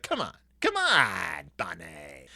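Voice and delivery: slow, drawn-out pleading that fades to nothing; lightly voiced little one nudging him along; coaxing toddler. Honey urging the bunny, slow and fading.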